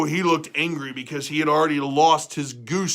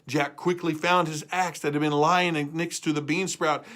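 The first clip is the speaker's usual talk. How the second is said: ordinary delivery, nothing marked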